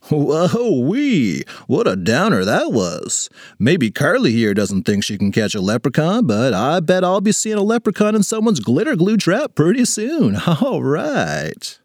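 hammy assembly host smoothing things over; jovial school principal. Acted. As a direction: swoop up and down like an upbeat principal.

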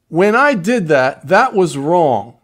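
slow, deliberate, each word weighted; slow model apology. Shown as a demonstration. Coach showing how to own a wrong, slow and deliberate. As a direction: spell the apology out slowly. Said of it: "This is how you apologize".

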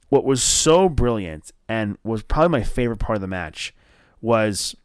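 normal talking voice

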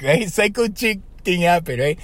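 ordinary delivery

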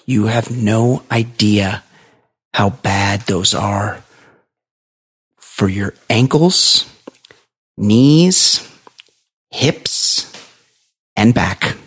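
very slow, deliberate list with long pauses; imitated grave warning. Shoe seller warns customer of injury, gravely. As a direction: weigh out every item like a serious hazard. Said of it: "he's really freaked out".

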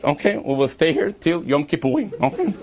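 normal talking voice

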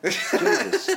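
high, loud cry of exasperation; fed-up Jesus flung out high; exasperated outburst. Real, not acted. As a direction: throw the word up in mock despair. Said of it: "You don't sound very mellow now".

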